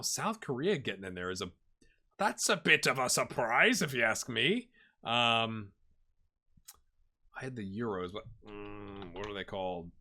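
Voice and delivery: ordinary talk, nothing marked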